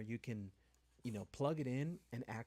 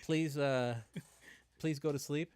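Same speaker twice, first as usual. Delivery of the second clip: pleading robot; 4 semitones higher, 8 dB louder than usual. Pretend. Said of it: "It's like".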